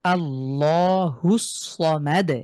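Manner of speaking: আল্লাহুস সমাদ slowly, recited, with a heavy l; a slow, drawn-out Quran reading; Quranic recitation. Shown as a demonstration. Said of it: "আমার সাথে একটু পড়ুন".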